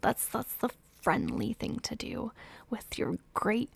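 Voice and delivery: half-whispered, teasing aside; knowing aside. Real, not acted. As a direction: murmur it with sly emphasis on friends. Sardonic aside about the friendship.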